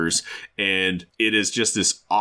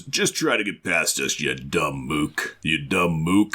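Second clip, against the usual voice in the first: slow, drawn-out tough-guy taunt; Ben; 4 semitones lower than usual. Acted. Ben warning off the demon, slow taunting voice. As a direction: stretch out the insult, lower at first. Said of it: "Ben's response is".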